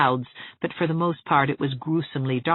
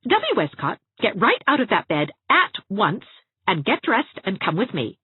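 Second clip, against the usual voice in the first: higher, forceful no-nonsense command; stern ordering; 7 semitones higher, 5 dB louder than usual. A narrator's reading. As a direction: order her up, allowing no argument. Anne orders Dovey out of bed.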